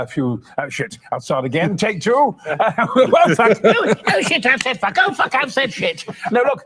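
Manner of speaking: ordinary talk, nothing marked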